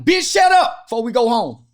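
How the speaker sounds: loud, high-pitched holler; hollering uncle. Acted. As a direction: yell it like the uncle at a family dinner. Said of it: "the uncle at Thanksgiving in the kitchen hollering".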